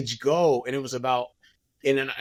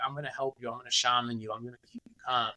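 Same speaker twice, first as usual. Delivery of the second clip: soothing calm; 3 semitones lower, 8 dB quieter, much faster than usual. Retold or imitated. Friend calming him on salvia.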